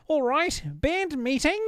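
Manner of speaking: very high-pitched New Zealand impression; New Zealand accent. Imitating Flight of the Conchords, New Zealand accent. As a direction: call it out in broad New Zealand. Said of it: "I think I have good New Zealand".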